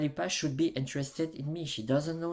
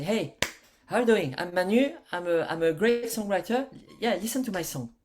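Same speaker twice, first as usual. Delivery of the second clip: higher, louder mock self-promotion; pushy songwriter; 5 semitones higher, 6 dB louder than usual. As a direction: pitch yourself with overeager confidence. Acts out a pushy songwriter pitch.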